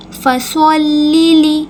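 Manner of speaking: فصل لیلی with a heavy emphatic ص, drawn out slowly; slow tajweed demo. Shown as a demonstration. Teacher to students, slow heavy ص demo. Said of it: "صاد کی آواز نکلے گی".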